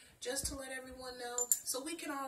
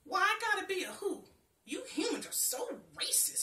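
high, loud, indignant tree voice; a touchy tree spitting out offense; spat; 8 semitones higher, 7 dB louder than usual. A narrator's reading. Tree scolds the girl for calling him who, loud and high. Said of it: "he spat".

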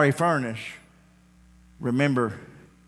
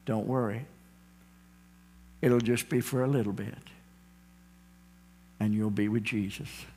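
put-on softer, reassuring words to a frightened child; comforting parents; 4 semitones lower, 7 dB quieter than usual. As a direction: soothe the child gently.

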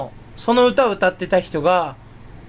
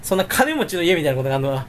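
usual speaking voice

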